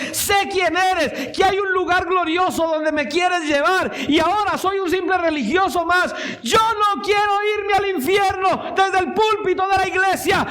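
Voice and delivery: very high, shouted plea; crying out to God. Retold or imitated. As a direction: beg at the top of your voice to be saved. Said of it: "empecé a gritar y empecé a clamar".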